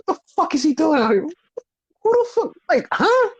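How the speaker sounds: very high, dumbfounded mock confusion; imitated speechless double-take; Seth Rollins. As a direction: act out total bafflement, pitch climbing. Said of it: "he has his face like".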